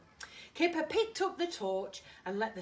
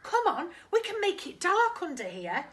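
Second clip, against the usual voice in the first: high, loud eager cub; excited Tiger voice; 10 semitones higher, 10 dB louder than usual. Acted. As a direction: coax a friend, bright and high. Tiger voiced in read-aloud story.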